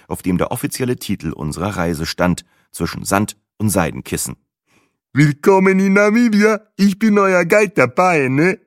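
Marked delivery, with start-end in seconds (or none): 5.1-8.6 hoch, laut, breit lächelnd begrüßend; begrüße die Gruppe mit breitem Lächeln; fröhlicher Reiseführer. A narrator's reading. Tour guide greets. (der Reiseführer begrüßt die Touristen fröhlich)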